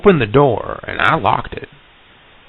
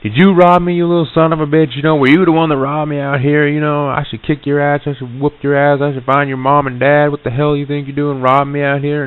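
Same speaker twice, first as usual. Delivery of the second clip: high, louder, furious tirade; retold outburst, raised angry voice; angry screaming; 7 semitones higher, 3 dB louder than usual. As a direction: yell accusations at a suspected thief. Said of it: "I just start screaming out".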